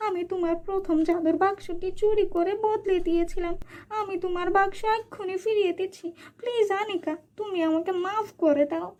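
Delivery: high, begging aunt voice; aunt confessing the theft, high and pleading. Acted. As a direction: beg her forgiveness, high. Aunt begs forgiveness.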